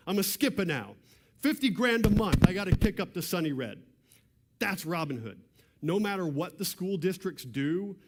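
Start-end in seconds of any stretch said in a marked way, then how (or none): 0.1-3.8 put-on New York mobster accent, higher and louder, loudest on the money line; irritable New York mob boss griping about his cut, in a hammed-up accent. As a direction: bigger second go at a New York gangster accent. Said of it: "I'm trying to do the accent".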